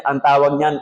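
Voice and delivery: ordinary talk, nothing marked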